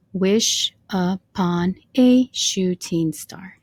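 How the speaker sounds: "Wish upon a shooting star" slow, broken into separate syllables. Shown as a demonstration. Teacher counting line five's syllables with students, syllable by syllable. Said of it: "if you counted with me".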